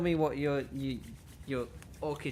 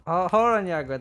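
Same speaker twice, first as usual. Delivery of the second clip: louder, higher "hold on"; alarmed protest; 5 semitones higher, 10 dB louder than usual. Acted. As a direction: stop her before it's too late. Character protests being volunteered.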